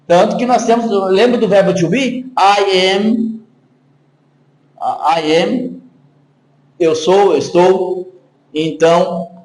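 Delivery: usual speaking voice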